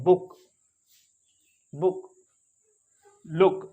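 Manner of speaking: बुक, लुक with a short u; short u sound. Shown as a demonstration. Teacher modelling the short u for the class. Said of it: "छोटा ऊ की आवाज़ है".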